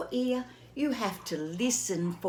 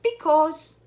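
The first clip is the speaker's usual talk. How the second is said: louder, higher stubborn because; Gerald's answer; 6 semitones higher, 9 dB louder than usual. Acted. Gerald answers Piggy, loud and blunt. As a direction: blurt it out like an elephant.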